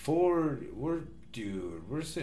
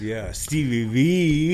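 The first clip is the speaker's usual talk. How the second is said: loud announcer; 3 semitones higher, 13 dB louder, slower than usual. Acted. Podcast sign-off hype.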